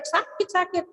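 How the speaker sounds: mild, high "ow" outcry; retold cry of pain. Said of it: "dia teriak-teriak".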